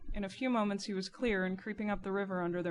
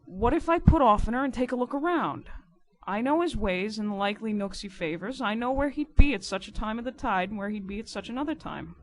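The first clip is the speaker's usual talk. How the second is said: louder, higher character voice; emphatic, raised-voice boatman; insistent riverside informer; 5 semitones higher, 7 dB louder than usual. Acted. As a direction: push your plan forcefully as the riverman. Narrator voices Riderhood volunteering.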